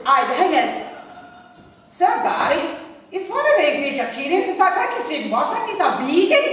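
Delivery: loud, high Coatbridge woman; Coatbridge accent. Retold or imitated. Caller giving her name to reception, loud Coatbridge accent. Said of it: "in her best Coatbridge accent".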